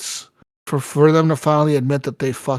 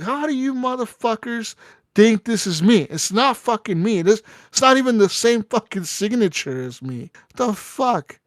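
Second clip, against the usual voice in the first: retold angry rant; 7 semitones higher than usual. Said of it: "I had a fight with her".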